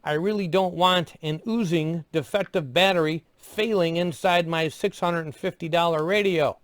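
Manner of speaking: normal talking voice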